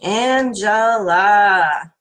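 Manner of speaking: Angela stretched very slow and loud; long slow sounding of the name Angela; drawn out. Shown as a demonstration. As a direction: drag out every syllable of Angela. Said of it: "I'm saying the name a little different".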